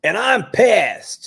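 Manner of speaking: high, loud, drawn-out mock outrage; mock angry contestant. Co-host mimicking an annoying past contestant, mock angry. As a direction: stretch it out slowly, high and loud.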